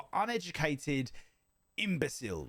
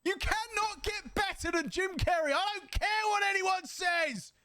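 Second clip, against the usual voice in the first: excited; 13 semitones higher than usual. Real, not acted. Streamer shouting his love for Jim Carrey, excited. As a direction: gush about him at full volume.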